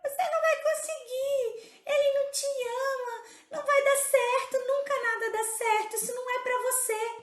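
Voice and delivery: high, tearful little voice of self-doubt; mock the inner critic, high and close to tears; sabotaging inner voice. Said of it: "Sabe aquela vozinha que fala assim". (voz interna sabotadora, aguda e chorosa)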